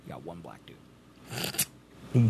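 low, slow, breathless whisper. Real, not acted. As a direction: hush it like a secret. Whispered aside.

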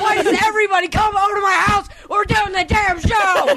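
high, laughing yell; laughing shout. Real, not acted. Yelling at someone outside.

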